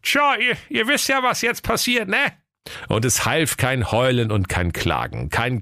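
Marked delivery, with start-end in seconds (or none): 0.0-2.3 high character voice. Acted. Character voice opening quote.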